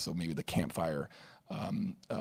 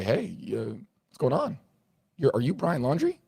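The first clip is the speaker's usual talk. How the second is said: mock officer, higher and louder, friendly query; officer questioning; 7 semitones higher, 6 dB louder than usual. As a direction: act out a cop casually asking. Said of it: "the officers come up to him and say".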